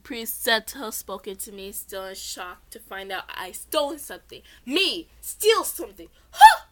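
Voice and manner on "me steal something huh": high, loud scoffing laugh; scoffing disbelief. A narrator's reading. Girl scoffs at the accusation of stealing. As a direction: scoff at the very idea. Narrator's mocking self-defence.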